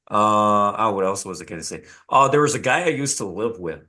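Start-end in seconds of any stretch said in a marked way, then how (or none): none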